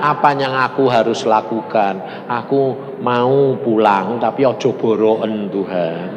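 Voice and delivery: low, slow plea to God; imitated humble prayer. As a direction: plead slowly, voice dropped. (meniru doa memelas)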